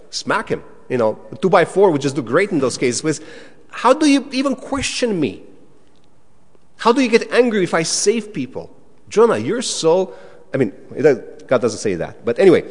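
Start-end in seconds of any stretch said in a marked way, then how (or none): 3.7-10.1 much higher, indignant scolding; outraged rebuke. Pretend. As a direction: scold Jonah like an exasperated boss. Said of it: "if I were God".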